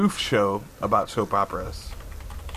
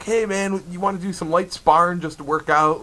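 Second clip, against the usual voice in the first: higher-pitched casual invitation; lightly acted, higher voice of a sparring-seeker; imitated gym challenger; 7 semitones higher than usual. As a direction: play the guy pitching a friendly spar. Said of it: "he would walk up to a guy".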